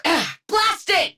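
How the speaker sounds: high, slow, exasperated curse; frustrated outburst. Acted. Cursing as the crystal stays shielded, high and slow. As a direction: spit it out at the setback.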